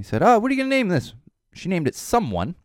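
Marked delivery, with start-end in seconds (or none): 0.2-1.1 high, singsong parent question; imitated high-pitched coaxing parent; parent talking to child. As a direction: ask a small child in a lifted voice. Parent voiced asking small child.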